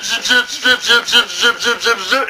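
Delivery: high mock zip zip; zip noises. Angry Joe's zipping, voiced high. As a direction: rattle off zips. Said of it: "Angry Joe does zip".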